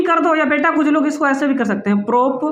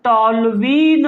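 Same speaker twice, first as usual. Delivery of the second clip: slow drilled answer; 3 semitones lower, much slower than usual. Shown as a demonstration. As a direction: spell out "toluene" slowly for the class. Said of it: "क्या हो जाएगा?".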